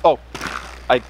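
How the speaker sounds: normal talking voice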